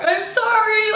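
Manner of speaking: high, strained teary apology; retold tearful apology, half-hearted; near tears. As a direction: plead like you're about to cry. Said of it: "I'm basically crying".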